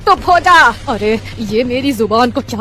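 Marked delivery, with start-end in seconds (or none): 0.1-0.7 तो हो जा blurted high and loud with a lisp; lisping by accident. Acted. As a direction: slip into a lisp and shout it. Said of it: "मैं क्यों तुतला कर बोलने लगी". (गलती से तुतलाकर ज़ोर से बोलती सास)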